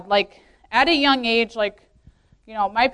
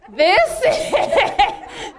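higher, very slow, laughing through it; slow laughing realization; 7 semitones higher, much slower than usual. Retold or imitated. Rolling over beside her snoring husband, slow laughing. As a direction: drag it out with a laugh.

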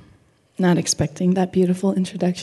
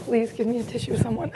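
laughing through tears, softer; a real laugh while holding back tears; teary laugh; 3 semitones higher, 7 dB quieter than usual. Real, not acted. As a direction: speaker laughs through tears asking for a tissue.

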